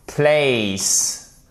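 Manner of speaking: Place, said slowly with a clear diphthong; careful phonetic demo. Shown as a demonstration. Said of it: "je vais vous la faire correctement en phonétique". (Place prononcé avec la diphtongue, lentement)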